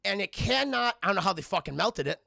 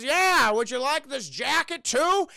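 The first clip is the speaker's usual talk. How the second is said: slow, high, louder mock old man offer; character voice; 6 semitones higher, 7 dB louder, slower than usual. Streamer voicing the farmer offering Logan a jacket, hammed-up. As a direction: hams up a kindly old farmer.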